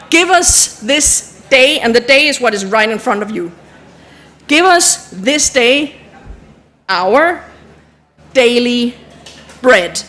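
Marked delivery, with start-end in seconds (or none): 0.1-1.8 drawn-out prayer words; slow it right down; slow recital. Shown as a demonstration. Teacher showing the sign for day, slow. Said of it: "recites while signing". 4.5-10.0 slow, pausing prayer line; slow prayer recital. Shown as a demonstration. Teacher walking the group through the line, slow. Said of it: "recites while signing".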